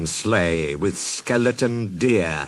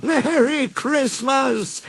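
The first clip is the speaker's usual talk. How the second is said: higher, slow jolly farewell; jolly Santa; 14 semitones higher than usual. Acted. Santa calling out as he leaves in his sleigh, jolly. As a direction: wink as you bid goodbye. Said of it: "he said".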